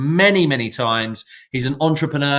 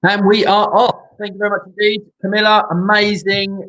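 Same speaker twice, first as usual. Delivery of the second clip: excited; 7 semitones higher, 4 dB louder than usual. Real, not acted. Host cheers after guest's talk.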